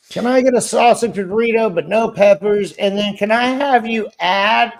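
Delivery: mock a customer reeling off special requests; fussy customer. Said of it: "I'm not like".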